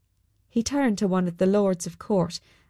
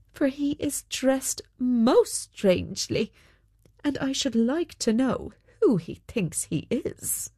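higher-pitched, puzzled monarch; lilting royal voice, raised in pitch; curious king character; 5 semitones higher than usual. Acted. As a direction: play the king intrigued by an odd stranger.

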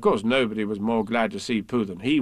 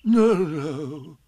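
wavering, higher-pitched casual denial; careless; 4 semitones higher, 4 dB louder than usual. A narrator's reading. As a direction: wave it off with a drawn-out warble. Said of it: "said Pooh carelessly".

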